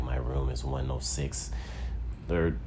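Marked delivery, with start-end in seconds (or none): 0.0-1.5 much softer seductive murmur; sultry hushed whisper. Retold or imitated. Fan whispering her room number in his ear, hushed and seductive. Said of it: "and, like".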